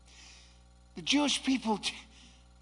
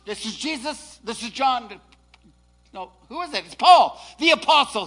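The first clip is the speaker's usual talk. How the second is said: ordinary delivery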